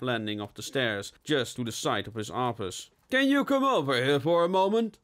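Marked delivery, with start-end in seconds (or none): 3.1-5.0 calling out. Acted. Chief calls from stairs.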